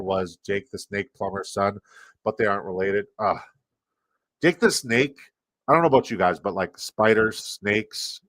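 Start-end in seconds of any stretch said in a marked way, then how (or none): none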